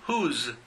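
whose ending in a buzzing z, huz; z sound. Shown as a demonstration. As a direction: stress the final z of whose. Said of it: "el sonido de la zetita".

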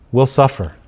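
ordinary talk, nothing marked

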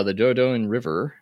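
ordinary talk

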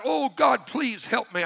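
acted desperate appeal; crying out. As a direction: plead upward for help, starting loud and high. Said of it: "And Jack cried".

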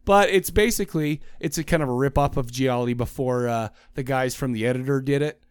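usual speaking voice

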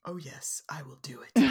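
unvoiced scheming whisper. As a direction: whisper it slyly to yourself. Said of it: "she's thinking".

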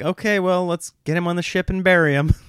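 put-on bossy little-kid voice; imitating kids. Kids deciding to bury their dad. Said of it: "instantly they're like".

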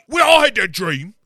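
high loud cockney yell; mock cockney shout. Acting Spice Girls banter.

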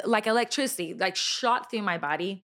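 ordinary delivery

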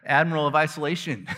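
high, loud, gushing mock reply; mock impressed official. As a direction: gush like a rival bureaucrat showing off. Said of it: "What do you do?".

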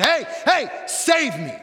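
high, loud shout for rescue; calling for help. Acted. Reaching out to someone for rescue, shouting. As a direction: call out urgently to someone. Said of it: "reached out to someone and said".

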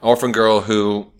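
normal talking voice

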